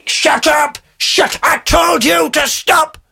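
high, slow furious yell; angry shout. Retold or imitated. Old woman shouting at the singer to stop, high furious yell. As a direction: bellow it at him to stop, pitched high. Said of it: "She's going".

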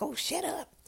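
high, taunting voice of the enemy. Retold or imitated. The enemy silencing her throat, high and taunting. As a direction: sneer it up high, mocking. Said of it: "That's what I think the enemy".

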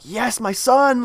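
high, slow joyful cry; overjoyed father hearing news; euphoric. Retold or imitated. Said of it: "euphoric moment".

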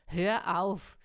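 loud, angry call; angry shout. A narrator's reading. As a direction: snap it out loudly. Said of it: "rief er".

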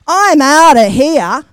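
loud, slowed panicked blurt; wanting out. A narrator's reading. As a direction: blurt it out in a rush of fright. Puppet panics, wants to flee.

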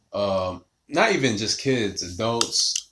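ordinary talk, nothing marked

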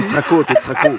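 chuckling through the words; laughing along. Acted. Innkeeper laughs along as friends mock his idea. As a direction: laugh it off, a good sport.

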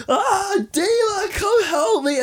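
very high, drawn-out damsel-in-distress wail; Kevin's mock cry for help. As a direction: cry out helplessly for rescue, hammed up. Acting Kevin crying for help.

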